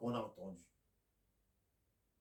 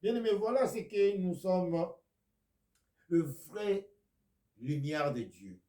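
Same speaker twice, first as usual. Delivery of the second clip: loud, high call to the beloved; a preacher's rousing exclamation; fervent preaching; 8 semitones higher, 9 dB louder than usual. Acted. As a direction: lift the voice to proclaim. Preaching burst to the faithful.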